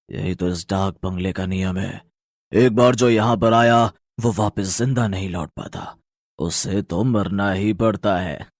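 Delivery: sinister ghost. Acted. Ghost explaining the bungalow's deadly rule to the family, sinister. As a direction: intone the deadly rule like a curse.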